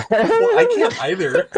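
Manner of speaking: high, loud laugh-filled words. Real, not acted. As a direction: squeal it out through laughter. Laughing at ape hands joke.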